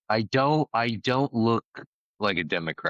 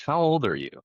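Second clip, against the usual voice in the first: higher-pitched joke-character question; lightly voiced leprechaun; 8 semitones higher than usual.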